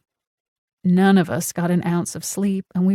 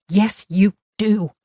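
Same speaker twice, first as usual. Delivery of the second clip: angry; 4 dB louder, slower than usual. A narrator's reading. Casey insists, pointing.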